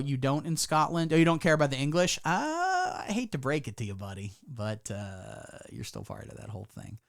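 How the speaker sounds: ordinary delivery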